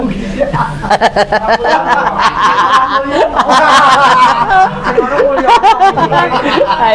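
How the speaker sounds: high shouted burst of laughter. Real, not acted. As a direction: roar with laughter. Big laugh at own joke.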